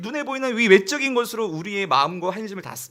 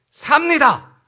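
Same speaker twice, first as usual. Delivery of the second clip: high, loud declaration; proclamation; 9 semitones higher, 9 dB louder, much slower than usual. Acted. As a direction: proclaim it to the whole people at a shout. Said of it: "선포해". (높고 크게 외치는 선포)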